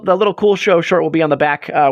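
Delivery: ordinary talk, nothing marked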